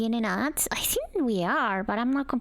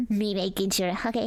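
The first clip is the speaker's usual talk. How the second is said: normal talking voice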